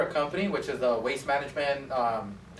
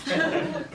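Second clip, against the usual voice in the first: high laugh; 13 semitones higher than usual. Real, not acted. Telling students the trash story, high laugh.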